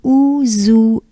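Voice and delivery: Ouzoue in separate parts, "ou-zou-é"; drawn-out Ouzoue with é set apart; unlinked syllables. Shown as a demonstration. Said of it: "let's start like this".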